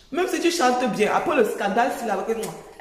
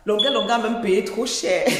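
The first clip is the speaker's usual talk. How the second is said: ordinary talk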